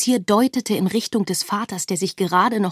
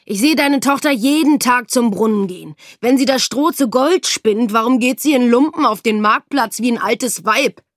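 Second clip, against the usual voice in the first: angry accusation; 3 semitones higher, 6 dB louder than usual. A narrator's reading. Angry accuser in tavern quarrel.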